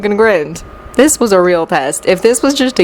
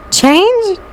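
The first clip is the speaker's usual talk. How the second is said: high, pleading single word; hopeful high-pitched beggar; begging; 11 semitones higher than usual. A narrator's reading. Jean begging the professor.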